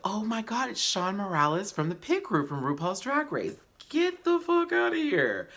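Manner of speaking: hammed-up, high fangirl shock; mock shocked gasping. Viewer reacting to a surprise cameo, mock shocked. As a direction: squeal it in high-pitched disbelief.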